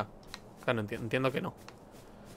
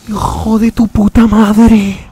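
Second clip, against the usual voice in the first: loud, dragged-out, high-pitched curse; slow furious yell; 7 semitones higher, 15 dB louder, much slower than usual. Real, not acted. Player roaring a curse at the boss, slow furious yell. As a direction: stretch every word of the insult. Cursing after the fight.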